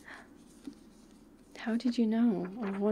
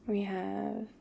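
usual speaking voice

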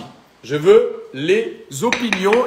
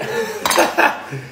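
high laugh; 14 semitones higher than usual. Real, not acted. Laughing at Lorraine's silence.

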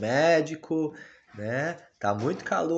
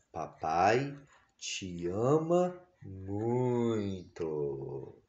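tender; 3 semitones lower, 6 dB quieter, much slower than usual. Real, not acted. Dad tells baby he loves her.

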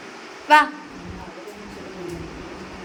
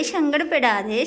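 put-on higher, sing-song voice soothing a crying toddler; coaxing baby talk; 5 semitones higher than usual. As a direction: coax the little one to stop crying.

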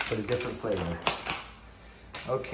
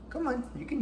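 squeaky praising a dog; 13 semitones higher, 5 dB quieter than usual. Real, not acted. Coaxing the dog.

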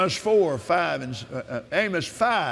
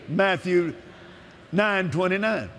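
ordinary talk

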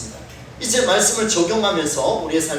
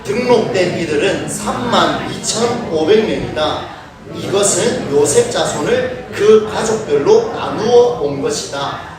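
reading scripture together; slower than usual. Shown as a demonstration. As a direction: read the verse aloud slowly with the congregation. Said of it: "한번 같이 한번 읽어볼까요?". (성경을 천천히 함께 낭독)

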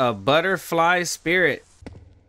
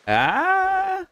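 startled scream. Real, not acted. Startled by game scare.

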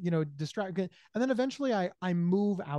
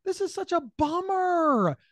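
pitched way up, slow mock surprise; hammed-up dismay; 12 semitones higher, 4 dB louder, slower than usual. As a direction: ham up the let-down, high and drawn out. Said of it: "feigned disappointment".

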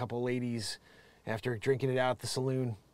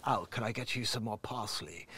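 softer, lower put-on voice of a prissy chef; a lightly voiced dopey chef offering parsley; stupid chef voice; 5 semitones lower, 9 dB quieter than usual. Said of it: "he has that stupid type of voice".

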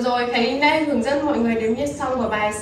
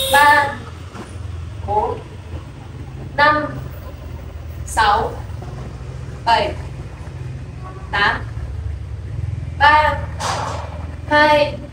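counting dance beats; much slower than usual. Shown as a demonstration. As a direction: call out each beat for the dancers. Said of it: "Chúng ta cùng đến với tổ hợp thứ nhất". (đếm nhịp nhảy to, dứt khoát)

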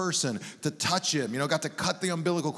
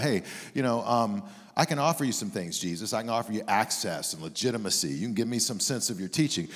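low, soft, tentative offer; understated negotiator's pitch, pitched down; casual deal-making; 7 semitones lower, 4 dB quieter than usual. Retold or imitated. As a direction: float a bargain on the quiet. Voices Nicodemus offering Jesus a deal.

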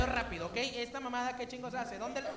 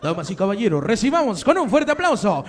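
loud showman intro; announcer voice; 6 dB louder than usual. Pretend. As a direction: hype up the crowd.

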